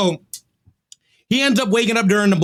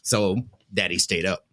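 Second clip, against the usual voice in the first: deadpan; 10 semitones lower, 6 dB quieter than usual. Real, not acted. Deadpan self-pitying punchline.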